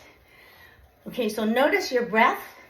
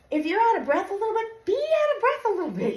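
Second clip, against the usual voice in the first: excited encouragement; 11 semitones higher, faster than usual. Real, not acted. Coach urging riders through breathlessness.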